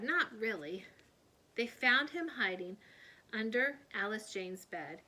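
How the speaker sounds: plain reading voice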